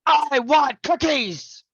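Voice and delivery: high, loud, slow whiny I want cookies; demanding child. Shown as a demonstration. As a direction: whine it like a spoiled kid demanding. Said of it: "Same thing, different tone".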